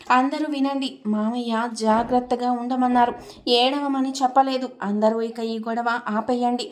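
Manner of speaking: plain reading voice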